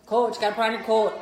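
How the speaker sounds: loud, broad Wisconsin round o; Wisconsin accent. Shown as a demonstration. Transplant imitating a reminder back home, loud Wisconsin accent. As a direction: call it out in thick Wisconsin. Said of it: "Wisconsin, Canada".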